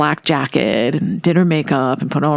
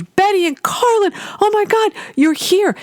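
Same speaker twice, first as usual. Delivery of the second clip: put-on squealed much higher, a thrilled young fan; gushing fan. Young editor thrilled to meet Betty and Carlin, squealing high. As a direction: announce it like a starstruck fan spotting idols. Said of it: "she announces, she goes".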